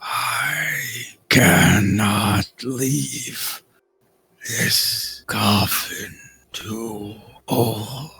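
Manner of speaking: very slow, drawn-out rasping words; eerie coffin creature. Acted. Coffin figure recognising the intruders, slow eerie rasp. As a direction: labour each word out like something long entombed.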